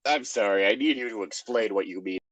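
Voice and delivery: usual speaking voice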